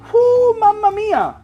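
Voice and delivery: loud, high, slow whoo; excited. Acted. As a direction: belt it out with delight. Promising an instant rock star.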